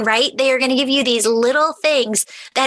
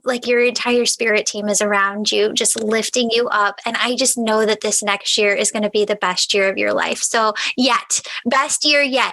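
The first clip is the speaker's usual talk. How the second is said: usual speaking voice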